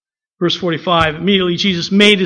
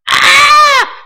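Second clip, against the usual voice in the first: high, piercing mock scream, louder; mock girlish scream; 6 dB louder than usual. Disciples seeing a ghost on the lake, hammed-up high scream. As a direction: shriek like frightened fifth grade girls. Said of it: "they scream like a bunch of fifth grade girls".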